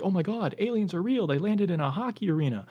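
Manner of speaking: high, fast panicky gush; mock shock. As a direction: play a startled crowd losing it. Earth reacting to alien landing.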